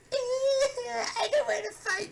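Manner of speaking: high, whiny cartoon wail; hammed-up high-pitched sobbing; mock crying. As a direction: imitate crying Pokemon, squealing over the words. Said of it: "battling with each other and crying".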